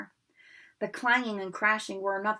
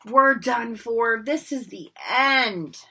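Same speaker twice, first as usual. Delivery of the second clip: slow and loud despair; despairing moan; 4 semitones higher, 7 dB louder, much slower than usual. Acted. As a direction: wail it hopelessly. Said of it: "Ron moaned".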